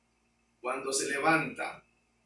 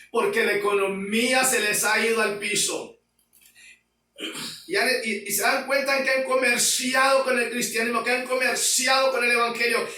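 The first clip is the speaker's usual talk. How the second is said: loud, high, impassioned sermon voice; fiery preaching; 6 semitones higher, 4 dB louder than usual. Acted. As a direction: thunder the warning at the congregation. Preaching at full force.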